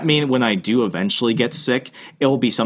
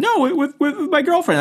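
much higher mock voice of a panicked friend; retelling a friend's call, imitating him much higher. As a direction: play the friend up high and fretful. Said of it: "I'm like".

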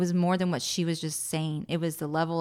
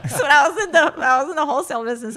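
laughing loudly through the words; 9 semitones higher, 11 dB louder, much faster than usual. Real, not acted. Guest telling the host about her sales career, laughing. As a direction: talk while still laughing hard.

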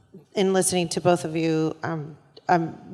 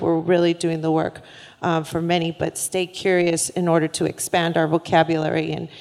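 ordinary talk

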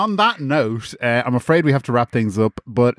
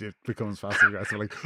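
ordinary talk, nothing marked

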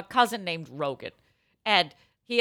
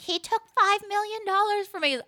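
mock witness; 12 semitones higher than usual. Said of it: "She's like".

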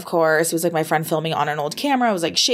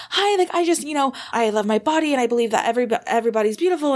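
higher-pitched, stumbling audition pitch; retold nervous rambling; 6 semitones higher, faster than usual. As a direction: imitate a nervous newbie rambling upward. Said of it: "I was really nervous and kind of rambling".

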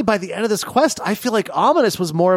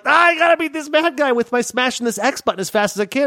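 excited; 8 semitones higher than usual. Retold or imitated. I'm like.

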